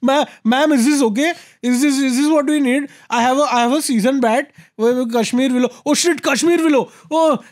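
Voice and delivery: high, anxious pleading voice; frantic. Pretend. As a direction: panicky eager-to-please plea. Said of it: "send her these frantic emails".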